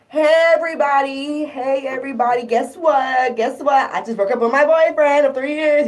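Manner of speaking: high, loud, giddy calling out to strangers; shop-floor town crier, thrilled; gleeful announcement. Retold or imitated. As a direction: broadcast the breakup like great news. Announcing breakup in every store.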